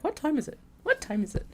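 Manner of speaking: high, anxious repeated question; impatient self-quote. Retold or imitated. Checking the clock over and over, mock fretful. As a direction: nag yourself about the time. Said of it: "me constantly being like".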